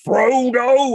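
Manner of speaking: loud, high mock-angry roar; Gandalf. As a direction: erupt suddenly, hammed-up fury on the name. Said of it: "you shouldn't be this angry at him".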